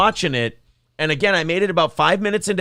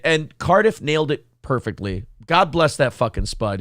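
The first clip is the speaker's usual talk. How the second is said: usual speaking voice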